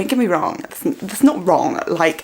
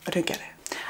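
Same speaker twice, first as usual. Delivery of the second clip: whispered aside; 12 dB quieter than usual. Real, not acted. Baffled aside to the camera.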